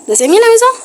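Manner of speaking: high excited question. Real, not acted. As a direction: pop up with excitement. Excited question to another player.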